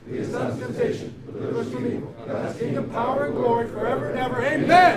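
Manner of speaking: murmured Lord's Prayer in unison; group prayer. Real, not acted. Team recites Lord's Prayer together.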